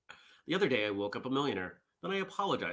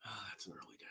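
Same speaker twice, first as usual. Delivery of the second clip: whispered aside; 5 semitones lower, 16 dB quieter, faster than usual. Real, not acted. Muttering to self about schedule.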